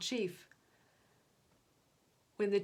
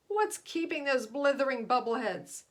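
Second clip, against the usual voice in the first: loud, angry demand; bellowing rage; 4 semitones higher, 6 dB louder than usual. A narrator's reading. Chief raging at his missing spacemen, loud and angry. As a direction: fume at the idiots. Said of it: "he bellowed".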